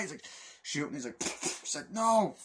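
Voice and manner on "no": high, loud acted cry; mock shout. Man in the plane shootout, high mock shout. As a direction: yell it as a retold action line.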